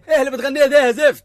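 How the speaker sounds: an angry father yelling at his son's singing, fast and loud; angry rebuke. Acted. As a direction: snap at the son fast and loud.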